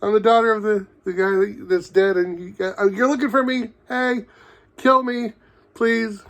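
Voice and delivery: high-pitched mocking calls for attention; Kwan's mock yelling. Reviewer mocks Kwan yelling out her identity, high calling voice. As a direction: call out in a silly high voice, imitating her. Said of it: "she's just yelling out that".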